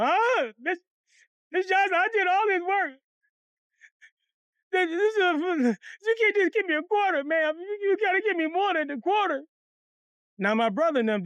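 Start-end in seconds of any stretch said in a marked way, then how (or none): 0.0-9.4 high, whiny tearful pleading; hammed-up crying protest, pitched way up; mock crying. Boy begs Miss Johnson for more pay, mock crying. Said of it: "Now I'm crying like a motherfucker".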